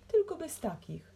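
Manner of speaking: loud, high rebuke; heated indignation. A narrator's reading. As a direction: snap back, affronted. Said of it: "zacietrzewiłem się".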